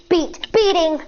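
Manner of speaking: ordinary delivery, nothing marked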